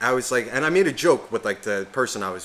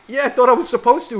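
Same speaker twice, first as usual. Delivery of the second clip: naive high voice; 12 semitones higher, 9 dB louder than usual. Retold or imitated. Naive self answering friend.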